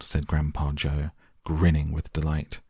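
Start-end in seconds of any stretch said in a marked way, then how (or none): none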